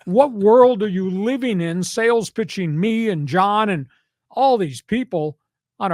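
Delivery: high, indignant sports rant; preaching. Real, not acted. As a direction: preach it in drawn-out, incredulous bursts. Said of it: "End, end of my statement and preach".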